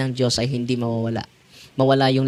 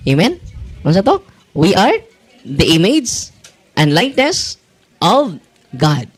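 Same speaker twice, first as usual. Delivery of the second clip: rallying call; 4 semitones higher, 5 dB louder than usual. Real, not acted. Preacher drilling a response.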